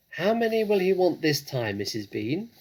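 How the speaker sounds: high, loud shout to upstairs; shouting up the stairs. Acted. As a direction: shout it up to the house. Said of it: "the woman shouted".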